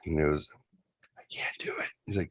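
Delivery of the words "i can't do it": scared unvoiced whisper; whispered refusal, too scared to move; frightened whisper. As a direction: whisper it, frozen with fear. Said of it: "he looked like something was wrong".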